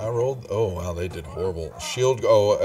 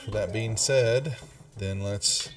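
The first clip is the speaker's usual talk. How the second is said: normal talking voice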